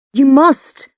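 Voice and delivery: high, loud desperate shout. A narrator's reading. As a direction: yell MUST at him. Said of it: "I shout again".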